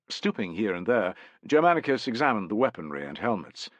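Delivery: ordinary delivery, nothing marked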